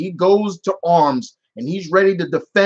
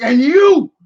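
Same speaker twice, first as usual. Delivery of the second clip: louder, high accusing mock rant; Mario; 6 semitones higher, 6 dB louder than usual. Mario turning on the next target, loud mock anger. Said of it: "he just goes on a rant".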